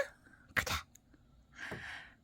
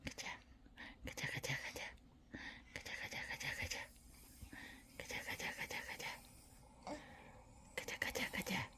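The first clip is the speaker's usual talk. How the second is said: whispering; 3 semitones lower, 27 dB quieter than usual. Real, not acted. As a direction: whisper so dad stays asleep. Sneaking to wake dad.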